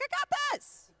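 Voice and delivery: squeaky high mock-American cheer; hammed-up confident American voice. Remembering switching to an American accent, squeaky high mock cheer. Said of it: "I was like".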